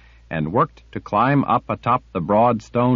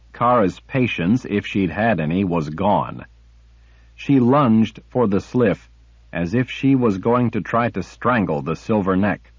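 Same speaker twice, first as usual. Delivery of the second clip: ordinary delivery, nothing marked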